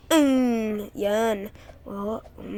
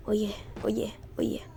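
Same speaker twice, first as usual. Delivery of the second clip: whispered hype; 2 semitones lower, 9 dB quieter, faster than usual. Real, not acted. Gamer hyping a jump sequence, whispered. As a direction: whisper oh yeah under your breath. Quiet hype while jumping.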